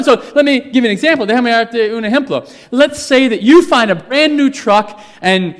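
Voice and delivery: loud, high, emphatic preaching; preaching at full force. Acted. Preacher pressing the church, loud and rising. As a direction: drive it home to the congregation.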